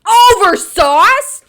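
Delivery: slow, loud, high incredulous question; outraged disbelief. Real, not acted. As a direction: stretch it out in total outrage. Outraged at being judged.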